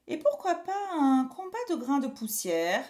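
high, loud playful exclamation; exclaiming father. Acted. Father tops the family joke, exclaiming. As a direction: exclaim it as a bright idea. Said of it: "s'exclama le papa de Pierre".

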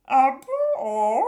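a witch swooning at a compliment; delighted witch. A narrator's reading.

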